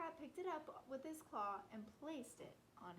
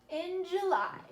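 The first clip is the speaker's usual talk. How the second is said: loud, higher ringing heading; announced month; 6 semitones higher, 16 dB louder, slower than usual. A narrator's reading. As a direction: call the month out brightly.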